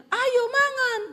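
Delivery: very high, loud call to eat; piercingly high invitation; high Sulawesi tone. Retold or imitated. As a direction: shout it up high, Sulawesi-style. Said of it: "nadanya tinggi, nada orang Sulawesi".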